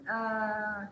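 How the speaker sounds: ordinary delivery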